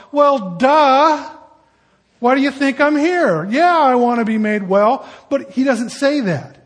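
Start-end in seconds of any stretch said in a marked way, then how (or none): 0.1-5.1 loud, higher mock exasperation; sarcastic duh. Preacher voicing the sick man's scornful reply, loud and sarcastic. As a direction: throw it back like the answer is obvious. Sarcastic retort to Jesus' question.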